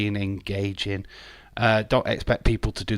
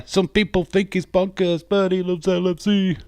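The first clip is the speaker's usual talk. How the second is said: hype intro; 9 semitones higher, 4 dB louder than usual. Acted. Intro voice for a viewer.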